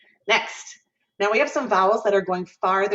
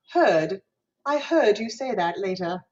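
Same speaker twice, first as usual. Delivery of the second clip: British r-less "heard" and "later"; British accent; 2 semitones higher than usual. Shown as a demonstration. Teacher showing how Brits drop the R, British accent. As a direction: demonstrate British speech, dropping the hooked R. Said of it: "Or in a British way".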